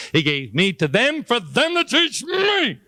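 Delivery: drawn-out high exasperated squeal; exasperated outburst. Acted. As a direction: climb very high and stretch out the punchline. Punchline of exasperated father's realisation.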